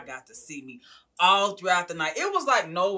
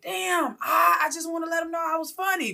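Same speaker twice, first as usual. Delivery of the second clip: excited self; 12 semitones higher than usual. Retold or imitated. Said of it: "I was just like".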